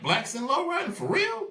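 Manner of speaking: high squeak of amazement; disbelieving caller. Retold or imitated. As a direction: voice leaps way up in disbelief. Caller amazed blacks in lowriders.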